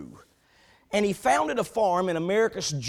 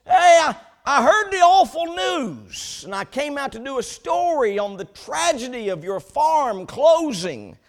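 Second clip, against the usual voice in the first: reporter shouting across the field, much higher and louder; calling out loud; 5 semitones higher, 6 dB louder than usual. Retold or imitated. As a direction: call out loudly from a distance. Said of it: "lifts up his voice".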